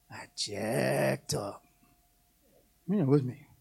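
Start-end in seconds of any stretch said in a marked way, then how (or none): 0.1-1.6 low, slow, sheepish admission; hushed I jacked up; mock shamefaced confession. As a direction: confess it quietly like you messed up. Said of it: "And you realize".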